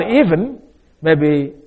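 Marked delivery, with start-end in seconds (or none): 0.0-0.6 "even" lifted high and pushed out; stressed word. Shown as a demonstration. Preacher stressing "even" to the congregation, raised and pushed. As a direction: lean hard on "even". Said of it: "Now the word".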